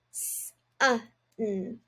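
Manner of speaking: s, uh, n, one sound at a time; sounding out sun. Shown as a demonstration. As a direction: split "sun" into s, uh, n. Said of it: "break down each syllable into its sounds".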